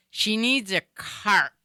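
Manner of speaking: CART drawn out slowly with a clear R; over-articulated translation. Retold or imitated. Other person translating for the baffled customer, slow over-articulated CART.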